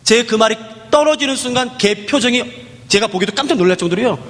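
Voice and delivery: usual speaking voice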